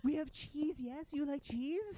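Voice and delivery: high, hopeful creature voice; placating ratling. Acted. Ratling offers cheese in place of coin, high placating voice. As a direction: offer it high, hoping to please. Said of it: "they said".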